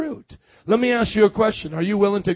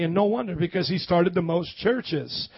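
usual speaking voice

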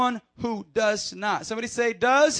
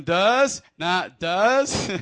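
mimicking their tone; 3 semitones lower, 6 dB louder, much slower than usual. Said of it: "You guys said in that tone".

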